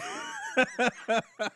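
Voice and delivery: high, bursting laugh; real laugh that breaks out after a punchline; laughter. Real, not acted. Storyteller cracking up at his own retort, laughing high.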